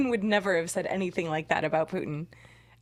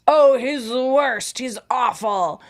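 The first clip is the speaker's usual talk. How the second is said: mock disgusted politician; 3 semitones higher, 7 dB louder, slower than usual. Imitating Biden about Putin.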